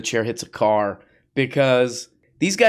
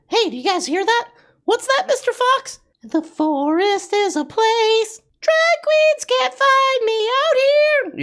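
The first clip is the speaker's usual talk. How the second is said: very high squeaky cartoon voice, mocking; squeaky parody voice; 3 dB louder than usual.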